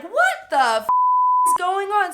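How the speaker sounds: high, loud startled mom; a mother jolted awake in a panic; alarmed mom. Retold or imitated. Mom waking to find her daughter collapsed, high and loud. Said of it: "My mom's like".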